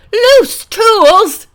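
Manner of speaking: high, loud furious scream. A narrator's reading. Tied-up bus driver furious at the girls, loud high scream. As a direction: scream the words in outrage. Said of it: "screamed the little bus driver".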